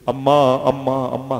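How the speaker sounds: put-on a child weeping at his mother's grave, crying out. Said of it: "कह के रो रहे थे".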